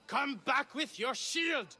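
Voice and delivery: hammy stage war cry; rehearsed battle cry. Acted. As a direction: bellow it like a general rallying soldiers. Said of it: "I was rehearsing a monologue".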